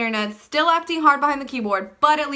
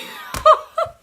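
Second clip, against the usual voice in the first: high breathy laugh; 5 dB louder than usual. Real, not acted. Laughs at the memory.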